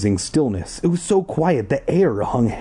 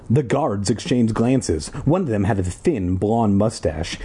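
ordinary delivery, nothing marked

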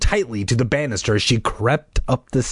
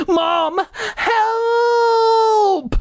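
frightened cry. Acted. Brother calling Mom for help, terrified scream. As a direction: scream it out in terror. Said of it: "his feelers quivering in fright".

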